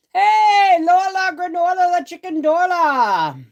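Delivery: high, loud singsong hello; singsong greeting. Real, not acted. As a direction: call out a playful rhyming hello to viewers. Singsong greeting to chat viewers.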